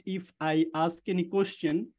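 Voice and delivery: ordinary talk, nothing marked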